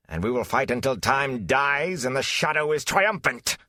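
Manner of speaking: high, mocking villain rant building to a shout. Betrayer ranting at the hero, building to a shout. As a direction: scorn him, then roar the ending. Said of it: "He finished in a shout".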